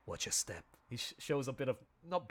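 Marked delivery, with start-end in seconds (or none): 0.1-0.6 low, softer leader's line, lightly imitated; playing it cool. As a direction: act lightly unfazed, pitched down. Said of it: "play it off cool".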